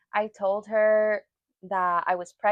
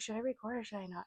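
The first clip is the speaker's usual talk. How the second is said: a quick, hushed aside, much softer; hushed question; 15 dB quieter, faster than usual. Retold or imitated. Whispering to Richie, unsure about filming, fast and hushed. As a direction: whisper it fast so nobody at the table hears.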